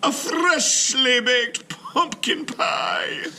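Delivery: very high, slow, loud sing-song; sweet old lady. Acted. Missus Wilkins offers Mister Klug a treat, high and sing-song. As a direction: present it like a delighted granny.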